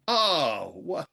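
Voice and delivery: ordinary delivery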